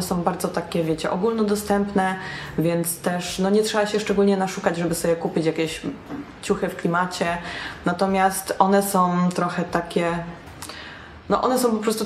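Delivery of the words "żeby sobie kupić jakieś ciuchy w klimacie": ordinary talk, nothing marked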